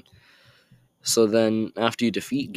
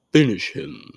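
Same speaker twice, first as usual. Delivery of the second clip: higher, louder game announcer imitation; Mortal Kombat voice; 6 semitones higher, 5 dB louder than usual. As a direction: punch it out like a fighting-game command. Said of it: "Trying to do my best Mortal Kombat voice".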